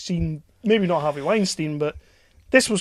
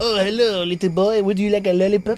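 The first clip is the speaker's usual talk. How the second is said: mock French accent; 3 semitones higher, 4 dB louder than usual. Joking creepy stranger offering sweets, mock French accent. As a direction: coax in a hammed-up French accent. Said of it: "That's French".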